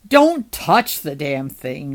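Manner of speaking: low, loud, slow order; forceful low-pitched command; stern warning. A narrator's reading. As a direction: warn her off firmly, leaning on each word. Noah warns July off the head.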